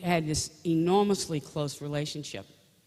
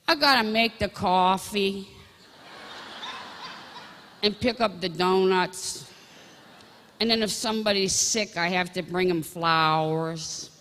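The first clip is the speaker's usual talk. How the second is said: imitating Billy; 4 semitones higher, 4 dB louder than usual. Imitating Billy explaining deacon duties.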